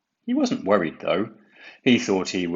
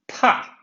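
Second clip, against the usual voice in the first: louder dismissive pah; scornful pah; 6 dB louder than usual. A narrator's reading. Percy scoffing at rude Cranky, dismissive pah. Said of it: "said Percy".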